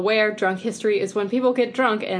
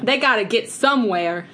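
higher, louder mock New York Italian; New York Italian accent; 4 semitones higher, 5 dB louder than usual. Shown as a demonstration. Retelling the Christmas story for laughs, New York Italian accent. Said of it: "New York Italian accent".

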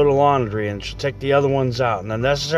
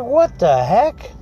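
much higher and drawn out, slow; exasperated; 9 semitones higher, 4 dB louder, much slower than usual. Retold or imitated. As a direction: stretch it out in disbelief. Frustrated reaction to wife.

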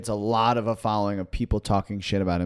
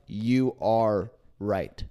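slow awed agreement; 4 semitones lower, much slower than usual. Retold or imitated. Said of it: "they're like".

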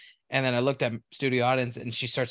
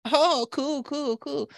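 mock barista chirp; 14 semitones higher, much slower than usual.

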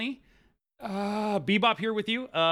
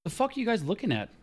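high-pitched, thrown-off question; totally lost and bewildered; baffled outburst; 8 semitones higher, faster than usual. Acted. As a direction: demand to know what's going on. Left out of jargon talk.

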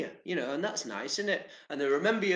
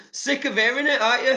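louder, higher teasing jab; 7 semitones higher, 8 dB louder, faster than usual. Real, not acted. Vlogger teasing viewers about lockdown talk, loud and playful.

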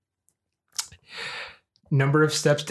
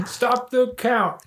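mock slow shout; 9 semitones higher, much slower than usual. Mocking the election count.

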